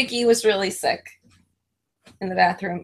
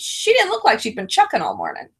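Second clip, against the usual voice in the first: ordinary delivery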